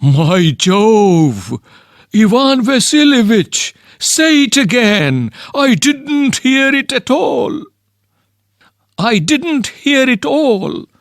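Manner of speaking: put-on old father thrilled by a marriage proposal; delighted shock. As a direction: gasp as if you can't believe your ears. Chubukov stunned by proposal.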